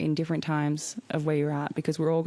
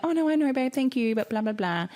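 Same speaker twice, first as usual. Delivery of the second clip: brushing it off; 8 semitones higher than usual. Retold or imitated. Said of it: "brushed it off".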